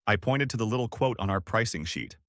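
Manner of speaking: ordinary delivery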